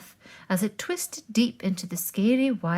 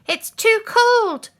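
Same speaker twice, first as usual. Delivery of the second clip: high, slow shouted complaint; 13 semitones higher, 6 dB louder, slower than usual. Acted. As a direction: call out loud and high, dragging the words. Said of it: "Nut shouted".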